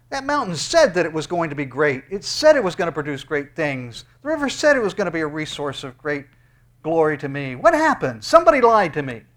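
loud, rising to a high cry at the end; full-force preaching. Acted. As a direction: preach it out, then cry the question.

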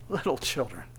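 soft, low, tender address; tender, compassionate. Acted. Jesus bending down to his little children, tenderly. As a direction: bend down gently to a child. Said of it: "this tender, compassionate".

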